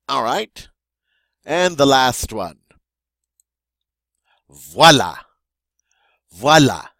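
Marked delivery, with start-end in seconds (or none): 4.5-6.9 "Voilà" much louder, falling; emphatic French model. Shown as a demonstration. Teacher modelling the last word, loud and emphatic. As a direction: present "Voilà" with flair. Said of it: "that means there is".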